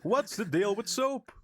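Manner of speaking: high-pitched observational stand-up voice; Jerry Seinfeld. Acted. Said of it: "that's a Jerry Seinfeld joke".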